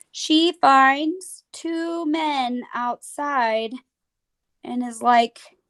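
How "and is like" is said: ordinary talk, nothing marked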